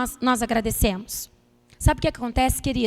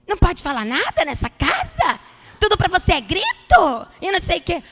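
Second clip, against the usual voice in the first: husband snapping back, high and loud; retold angry shout; 4 semitones higher, 6 dB louder than usual. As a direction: bark it back in irritation. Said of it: "por que você tá gritando?".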